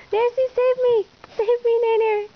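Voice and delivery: high, loud playful cry for rescue; hammed-up call to be saved; mock plea for help. Owner pretending the puppy attacks, mock high plea to the other dog.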